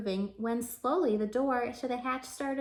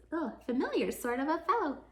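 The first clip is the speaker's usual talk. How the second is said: plain reading voice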